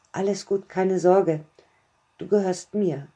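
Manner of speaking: usual speaking voice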